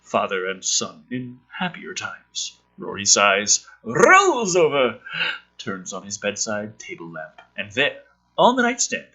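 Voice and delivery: script read with rising drama, big on the reveal; punchy storyteller read; dramatic script reading. Acted. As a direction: read it like a trailer, build to the last word. Reading screenplay aloud dramatically.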